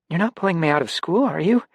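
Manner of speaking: put-on girl fearing her parents will pull her out, stammering; stammered. A narrator's reading. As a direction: blurt it out in a panic. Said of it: "she stammered".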